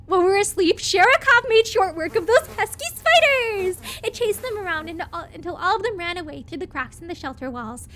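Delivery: lively game-text reading; story narration. Acted. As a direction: read it like a storybook. Reads game narration aloud.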